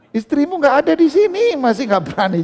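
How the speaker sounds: high, loud, laughing through the words; laughing tease. Real, not acted. Preacher teasing husbands afraid of their wives, laughing loudly. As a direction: tease them while cracking up.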